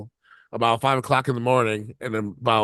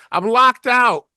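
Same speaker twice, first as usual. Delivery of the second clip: much higher and louder, a hammed-up panicked woman; mock stranded lady; 12 semitones higher, 8 dB louder, slower than usual. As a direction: squeal it like a lady calling from her car window. Lady yells from car window.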